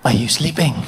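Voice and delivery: low, coaxing bedtime murmur; needy horny partner. Acted. Man behind partner in bed, low coaxing. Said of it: "he's there behind you".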